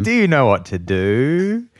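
rhyming verse. Real, not acted. Host keeps rhyming at amused co-host, sing-song. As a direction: lilt the question, stretching the rhyme. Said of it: "hope this whole bit's gonna be in rhyme".

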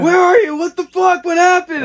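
high, loud panicked calling out; retold frantic yelling. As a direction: yell it like friends searching the river in a panic. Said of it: "They're, like, yelling for me".

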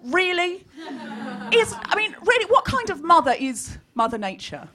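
ordinary delivery

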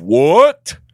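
loud, high-pitched "what" of disbelief; shocked outburst. Retold or imitated. Host reading a fan's review, loud mock shock. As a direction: burst out in acted astonishment.

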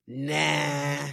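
loud flat refusal; shouted nah. Retold or imitated. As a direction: shout no in disbelief. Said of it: "I was like".

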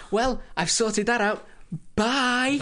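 airy, oblivious goodbye; breezy sign-off. Acted. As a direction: play a man blithely washing his hands of it. Acts Grenville breezily abandoning settlers.

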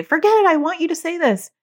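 mock frustration. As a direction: act out a kid losing patience. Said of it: "They might get frustrated and say".

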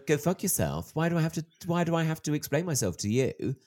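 worked-up defiant protest; defiant retort. Acted. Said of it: "you wanna go".

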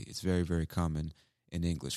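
usual speaking voice

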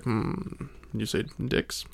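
hushed, sly, suspicious murmur; quietly fishing for gossip; a creepy guy pretending he heard nothing, hushed and sly; sly bystander. Creepy bystander acting innocent.

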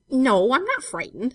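loud, high, drawn-out denial; firm denial. A narrator's reading. Girl reassuring Jem by the well, insistent.

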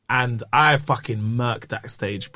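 normal talking voice

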